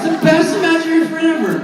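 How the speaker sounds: high, fast, gleeful gushing; mock giddy. Cheering the imaginary friend with a pal, giddy.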